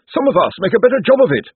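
high, loud snappish retort; snapping. A narrator's reading. Josephine retorts to Cosmo, snapping. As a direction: snap back at him sharply. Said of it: "snapped Josephine".

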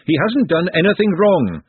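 high, loud, slow yell; screamed protest. A narrator's reading. Glenda defending Nut from the sisters, screamed protest. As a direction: protest at the top of the voice. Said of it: "she screamed".

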